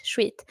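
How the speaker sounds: "Sweet" as "shweet", rounded sh, long ee; Swedish pronunciation. Shown as a demonstration. Streamer confirming to a viewer, Swedish pronunciation. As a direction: say "Sweet" plainly with a Swedish sh sound. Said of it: "That's how you pronounce it".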